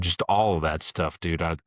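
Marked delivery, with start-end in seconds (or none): none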